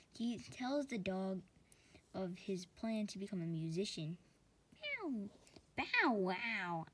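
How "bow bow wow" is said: dog bark. Acted. Dog barking in story.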